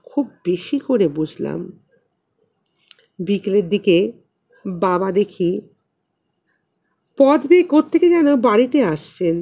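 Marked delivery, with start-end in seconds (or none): none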